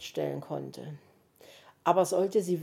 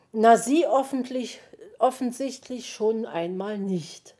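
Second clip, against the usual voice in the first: plain reading voice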